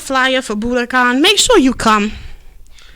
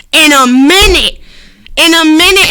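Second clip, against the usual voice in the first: emphatic; 5 semitones higher, 6 dB louder than usual. Real, not acted. Host lamenting no real sour diesel, loudly emphatic. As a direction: punch it loud and higher.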